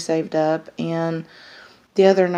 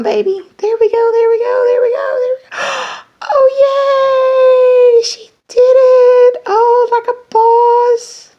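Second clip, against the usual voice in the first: very high, loud, crying with joy; real shrieking joy, much higher and louder; ecstatic shouting; 12 dB louder than usual. Real, not acted. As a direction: cheer like a parent at first steps. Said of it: "that was exciting".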